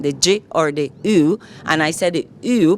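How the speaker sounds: ordinary talk, nothing marked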